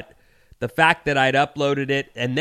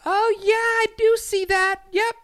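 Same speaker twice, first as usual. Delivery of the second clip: very high, sing-song mock friendliness; sugary customer service act, pitched way up; too-friendly clerk. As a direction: imitate a condescending, over-friendly Midwestern clerk. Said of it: "it's too friendly to be real".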